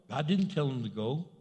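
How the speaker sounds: usual speaking voice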